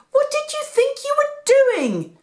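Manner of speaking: very high, loud frantic scolding; a mother scolding her lost son. A narrator's reading. Mary scolds Jesus after the frantic search, high and loud. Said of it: "Mary asked".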